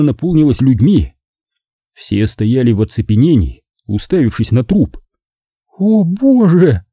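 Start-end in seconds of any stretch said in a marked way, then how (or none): 5.8-6.8 high, slow, thin gasp; тонким голосом, протяжно. A narrator's reading. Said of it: "произнесла Нина тонким голосом". (тонкий высокий голос)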